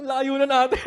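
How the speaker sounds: high, loud laughing protest; laughing retort. Retold or imitated. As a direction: laugh off the suggestion. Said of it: "Sabi ko".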